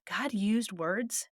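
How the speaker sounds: normal talking voice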